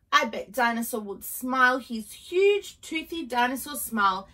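plain reading voice

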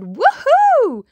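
high, loud, drawn-out whoop; excited cry. A narrator's reading. Monkey hearing the music, a loud high whoop. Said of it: "he cried".

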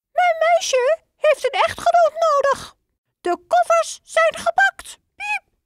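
very high, squeaky little mouse voice; high mouse voice ending in a piep; squeaky father mouse. Acted.

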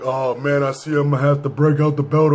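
lightly imitated rap intro. Vlogger replaying the intro, mock rapper voice. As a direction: mimic the rapper's spoken opener. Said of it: "You're like".

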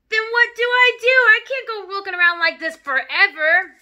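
high, loud desperate wail; panicked father. Acted. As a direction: despair loudly at being stuck like this. Father panics about ruined hair.

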